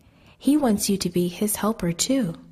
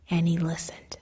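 plain reading voice

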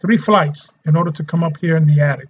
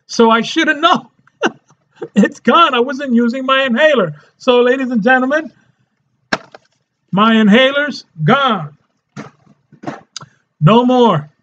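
excited; 6 semitones higher than usual. Real, not acted. Thrilled, sharing with viewers that his inhaler's gone. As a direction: voice lifting higher with glee, a laugh breaking through.

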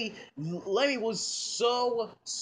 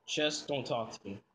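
low, soft brush-off; sulky; 7 semitones lower, 6 dB quieter than usual. Acted. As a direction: mumble it, wanting to be left alone. Sulking son rebuffs questions.

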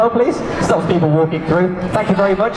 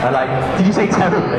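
ordinary talk

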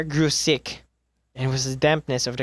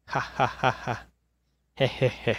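soft hollow chuckle from the niche; a quiet, unsettling little laugh; mild eerie laugh; 6 dB quieter than usual. Acted. Chained man laughing softly from the niche. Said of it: "a sad voice".

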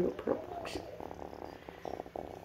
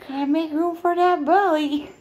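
higher, louder playful taunt; high teasing singsong; 7 semitones higher, 7 dB louder than usual. Pretend. Owner teasing pregnant pet about belly. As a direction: tease her like a child.

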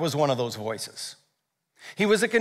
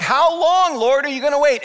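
desperate plea; 9 semitones higher, 6 dB louder than usual. Retold or imitated. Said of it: "Habakkuk is like".

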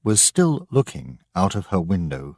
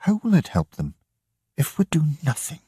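put-on defiant girl; 9 semitones higher, faster than usual. Grace argues with mother.

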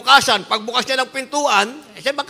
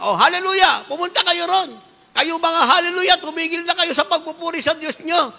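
higher mocking jeer; sneering taunt, pitched up; mocking scoffers; 7 semitones higher than usual. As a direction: call out the believers with scorn. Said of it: "tawag nila".

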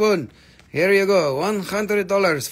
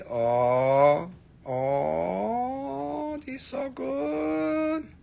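gushing; 8 semitones higher, 4 dB quieter, much slower than usual. Real, not acted. Gushing over a gem.

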